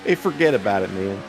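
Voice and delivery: loud mock New York "hey, forget about it"; tough-guy New York catchphrase; New York accent.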